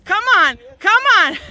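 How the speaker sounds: loud, high urging shout; nagging backseat driver. Real, not acted. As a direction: yell at them to keep pushing. Friends pushing car up hill.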